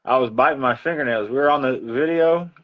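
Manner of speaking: usual speaking voice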